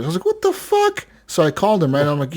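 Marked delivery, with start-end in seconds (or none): 0.3-1.1 much higher, incredulous; retold outrage at a bill, much higher; shocked. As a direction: squeak it out in disbelief.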